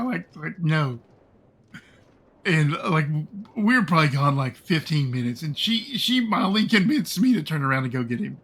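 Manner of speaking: ordinary talk, nothing marked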